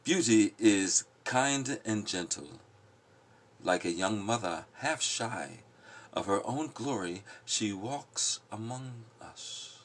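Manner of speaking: slow, measured poetry recital; poem reading. Acted. Reading The Prophet aloud to the group, slow poetic recital. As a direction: lift each line like verse read aloud.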